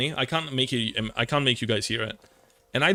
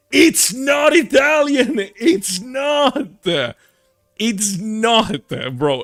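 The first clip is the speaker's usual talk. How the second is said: much higher, louder, slow frustrated yell; exasperated shout of It's not Italian; angry shout; 10 semitones higher, 8 dB louder, much slower than usual. Real, not acted. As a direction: yell it at the fans, furious. Angry retort to fan comments.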